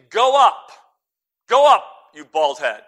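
loud jeer from a mob of youths; jeering shout. Retold or imitated. Youths jeering at the prophet, loud taunting shout. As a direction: yell it like a taunting kid. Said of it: "They say".

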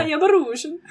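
high, laughing mock Russian accent; bad Russian accent. Shown as a demonstration. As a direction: botch a Russian accent through giggles. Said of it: "that's more Swedish".

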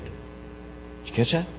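hushed check-in with the listeners; whispered aside. Real, not acted. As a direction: lean in and whisper it. Aside to congregation after quote.

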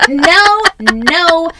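high, loud, laughing no no no. Retold or imitated. Mom refuses her son through loud laughter. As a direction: laugh while refusing firmly.

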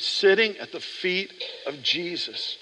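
slow and hushed; tender closing thought. Real, not acted. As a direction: draw them in gently. Soft slow devotional close.

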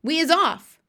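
loud, high, excited announcement; rousing cry. A narrator's reading. BFG sets out for the palace, rousing cry. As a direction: cry out the departure. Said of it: "cried the B F G".

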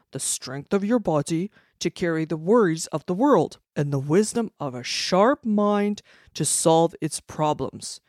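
loud proclamation. Acted. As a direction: proclaim it for all to hear. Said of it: "he proclaimed loudly for all to hear".

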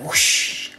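unvoiced-sounding rushing whoosh of breath; airy sound effect; imitated whoosh. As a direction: make a gushing noise with the breath. Imitates a gush while retelling.

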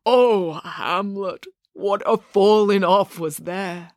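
slow, swelling ghostly lament; drawn-out, intoned ghost voice; ponderous ghost. A narrator's reading. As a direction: lament it as a ghost, stretching words. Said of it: "in a slow, ponderous voice".

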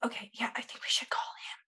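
hushed whisper. Acted. Partners whispering plans to each other, hushed. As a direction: whisper so the host can't hear.

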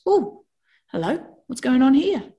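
high, startled wake-up voice; like a brain jolted awake; surprised brain. Retold or imitated. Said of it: "your brain goes".

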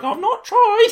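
high mock innocence; innocent protest. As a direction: squeak it innocently.

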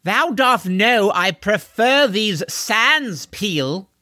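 high, slow haughty call; pompous shout. Acted. Horseman complaining to the driver up front, pompous shouting. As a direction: call out grandly, dragging the words. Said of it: "shouting to the front".

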